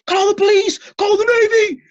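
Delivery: high, loud panicked shriek; shrieking coach. A narrator's reading. Coach seeing the monster, shrieking. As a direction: shriek in terror. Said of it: "shrieked the coach".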